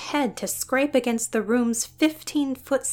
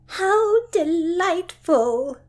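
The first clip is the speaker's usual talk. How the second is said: much higher, slow, loud gush; delighted cry; 8 semitones higher, 5 dB louder, much slower than usual. Acted. Gertrude clapping at the bowing dragon, delighted cry. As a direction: squeal with pure delight. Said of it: "Gertrude cried".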